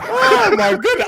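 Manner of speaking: high, slow, stunned oh my goodness; amazed realization. Real, not acted. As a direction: let it dawn on you, slowly and high. Reacts to realizing the idea.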